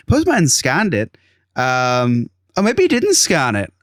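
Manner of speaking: a hint of a Scottish accent, unhurried; mock Scottish accent. Imitating the seller's Scottish accent as he explains the postman. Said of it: "That's how he talks".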